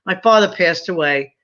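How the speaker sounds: normal talking voice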